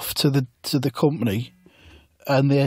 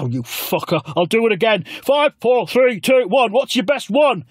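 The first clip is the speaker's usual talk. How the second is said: mock countdown again; 9 semitones higher than usual. The mopping man restarts the count in a high voice. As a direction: grumble then count fast and high.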